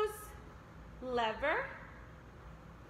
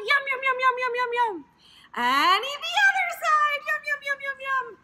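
playful yum yums; 8 semitones higher, faster than usual. Pretend. Pretend eating in stretch game.

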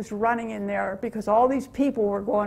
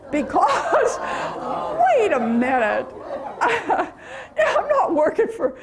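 laughing; 8 semitones higher, slower than usual. Real, not acted. Laughing at mafia's job offer.

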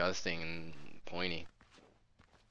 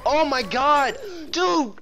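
high, loud overjoyed yelling; excited shout; 10 dB louder than usual. Real, not acted. Streamer thrilled that FaZe Clan joined his team, shouting. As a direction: shout it like a fan meeting a star.

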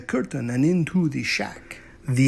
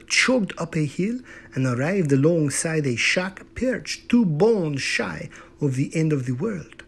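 usual speaking voice

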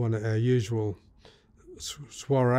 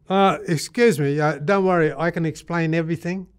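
louder, higher hurried excuse; mock-panicked appeasing, pitched up; flustered pleading; 6 semitones higher, 6 dB louder than usual. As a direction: act out a flustered attempt to explain. Caught at gunpoint, pleading to explain.

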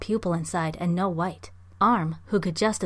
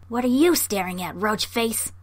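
snarl; 3 semitones higher, 4 dB louder than usual. A narrator's reading. Blue monkey insults the staring children, snarled challenge. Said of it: "it snarled".

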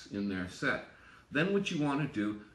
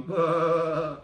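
long droning bah, louder; imitated slow warble; 3 semitones higher, 7 dB louder than usual. Shown as a demonstration. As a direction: hum the beat like a piano warble. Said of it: "the warbles will be going".

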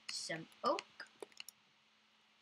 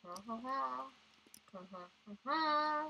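spelled-out fake laugh; mock laughing ha ha ha; over-pronounced fake laugh; 3 semitones higher, 3 dB quieter than usual. Mock laugh after joke.

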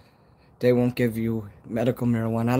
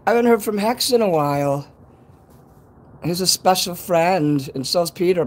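higher, louder, happy talk; excited; 5 semitones higher, 3 dB louder than usual. Real, not acted. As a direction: still buzzing from the greeting. Still excited about friends.

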